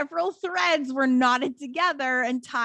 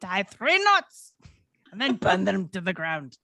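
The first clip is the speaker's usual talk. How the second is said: put-on Scottish accent; 5 semitones lower than usual. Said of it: "Is that your Scottish accent?".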